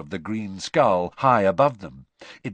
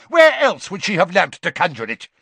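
loud, high demand; outraged challenge; angry shout; 8 semitones higher, 9 dB louder than usual. A narrator's reading. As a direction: shout the question accusingly. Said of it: "shouted Mister Crouch".

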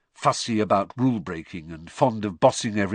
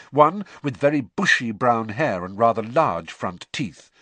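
usual speaking voice

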